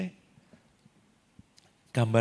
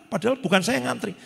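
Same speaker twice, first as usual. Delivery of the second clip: ordinary talk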